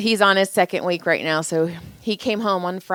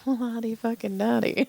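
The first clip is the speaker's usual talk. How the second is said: softer mock inner remark; inner aside; 4 semitones higher, 6 dB quieter than usual. Wife recalls her silent reply to the girlfriend, inner aside. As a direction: say it under your voice, as a private thought. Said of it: "in my head I was all".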